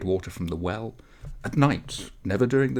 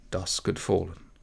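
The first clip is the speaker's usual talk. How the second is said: ordinary delivery, nothing marked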